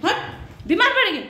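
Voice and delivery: high, sharp scolding call at the pet; shooing the pet. Real, not acted. Aunty shoos the pet chewing the broom, high-pitched scolding.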